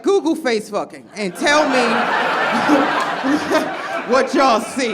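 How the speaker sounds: mock shout. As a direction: shout it through laughter. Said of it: "I was like".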